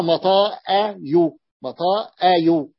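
"مطاء يو" slow, broken before يو; changed form, sounded out. Shown as a demonstration. Teacher showing a derivation step, slowly sounded out. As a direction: separate each syllable of "مطاء يو". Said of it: "فصار".

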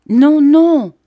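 high, loud pleading cry; anguished cry. A narrator's reading. Mrs Gummidge stopping Daniel, high loud cry. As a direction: shriek out to stop him. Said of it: "cria mistress Gummidge".

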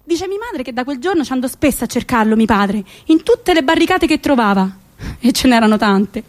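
ordinary delivery

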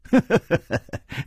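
light falling chuckle; a polite little laugh; smiling chuckle. Acted. Nan Gongling smiling at his guest, light chuckle. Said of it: "微笑着说".

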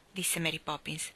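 plain reading voice

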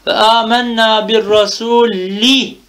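slow recitation, drawn-out final "i" in "rasuli"; audible kesra ending. Shown as a demonstration. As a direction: stretch "rasuli" and land clearly on its i ending. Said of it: "mecrurun bil kesrati zahira". ("rasuli" sonundaki esreyi uzatarak, belirgin oku)

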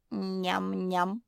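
voiced yum-yum chewing noise; munching. A narrator's reading. Zefirka snacks while advising Kuki, munching. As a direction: chew on the syllables greedily.

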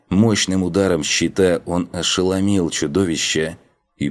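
plain reading voice

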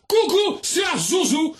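very high, loud coucou; spring the surprise; peekaboo shout. Acted. Zouzou reveals his birth to the guard, squealing high.

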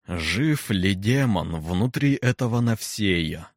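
slow, booming, impassive question; menacing deep voice. Acted. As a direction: let the words fall into silence. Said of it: "Грозный голос некроманта гулко падал в тишину, глубокий, низкий, бесстрастный".

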